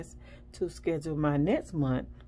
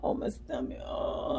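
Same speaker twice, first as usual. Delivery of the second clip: groaning in pain; 3 semitones lower, slower than usual. Retold or imitated. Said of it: "And I'm over here like".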